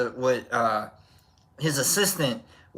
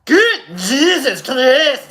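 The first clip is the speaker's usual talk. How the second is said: a hint of a Swiss accent, loud and slow; mock Swiss accent; 7 semitones higher, 7 dB louder, much slower than usual. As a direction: ham up a Swiss scientist. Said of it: "however they say it in Swiss".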